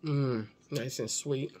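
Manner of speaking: talking between bites; chewing while talking. Real, not acted. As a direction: mouth partly full. Seen 3: Bites plantain at -1; cheeks full, chewing visible to about 2.1s.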